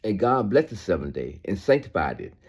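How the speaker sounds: ordinary delivery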